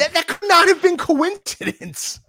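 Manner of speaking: very high, excited exclamation; incredulous insistence. Real, not acted. Insists it was no coincidence.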